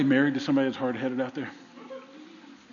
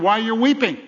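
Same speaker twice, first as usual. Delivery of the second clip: raised, louder gentle question; angels asking; 8 semitones higher, 6 dB louder than usual. Acted. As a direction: ask it with higher, louder concern. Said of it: "they ask the question".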